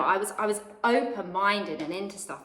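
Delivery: normal talking voice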